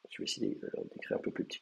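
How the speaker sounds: unvoiced mutter to himself, a bit fast; half-whispered note to self while writing; whispered aside. Real, not acted. Teacher at the board mutters to himself in a whisper.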